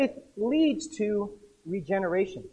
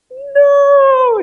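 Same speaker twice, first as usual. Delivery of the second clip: a sudden, much higher, very loud cry of No; shouted refusal; 15 dB louder than usual. Retold or imitated. Lazarus yells no as Jesus calls him back to earth, a high loud scream. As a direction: scream it like you're being yanked out of heaven.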